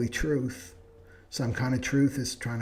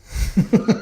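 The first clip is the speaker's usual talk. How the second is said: high breathless burst of laughter; loud unvoiced giggle; laughing; 9 semitones higher, 9 dB louder than usual. Real, not acted. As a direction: crack up at the joke. Laughing at own punchline.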